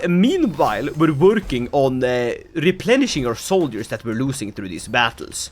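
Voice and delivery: ordinary talk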